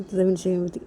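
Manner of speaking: ordinary delivery, nothing marked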